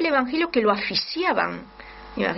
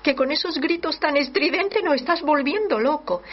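much higher, fed-up hush; mock annoyed apostles; 7 semitones higher than usual. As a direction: mimic the crowd silencing a nuisance. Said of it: "le decían".

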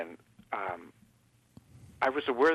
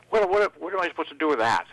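higher, louder imitation of an exasperated doctor; retold exasperation; 5 semitones higher, 5 dB louder than usual. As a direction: throw up your hands in mock exasperation. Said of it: "he just threw up his hands and says".

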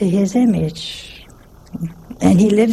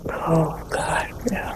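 horrified whisper; 3 semitones lower, 10 dB quieter, slower than usual. Retold or imitated. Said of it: "She got".

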